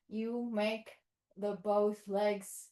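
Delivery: ordinary talk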